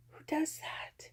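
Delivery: slow breathy whispered aside. Pretend. Whispering to viewers about her own excuse, slow breathy whisper. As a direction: hush it like a guilty secret.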